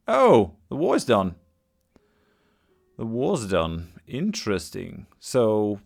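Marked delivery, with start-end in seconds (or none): 0.1-1.4 sudden, loud burst of surprise; surprised. Real, not acted. Player suddenly sees the war is over, surprised. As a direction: start high and loud on the realisation.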